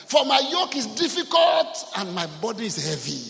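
loud shouted ironic recital; sarcastic shout; mock misquote. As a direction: shout the wrong verse in mock earnest. Mocking misquoted verse, shouted.

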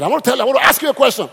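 fast, high and loud; imitating a shouting preacher; preacher's shout. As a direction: fire the question out like a screaming preacher. Preacher voiced screaming in story.

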